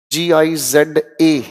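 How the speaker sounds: Giza spelt letter by letter, very slow; slow spelling. Shown as a demonstration. Teacher spelling Giza to students, very slow. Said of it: "spelling Giza slowly".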